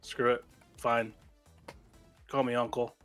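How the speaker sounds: normal talking voice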